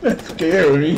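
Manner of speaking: loud startled exclamation; startled exclaim. Real, not acted. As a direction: blurt it out, shaken. Reacting to the blast.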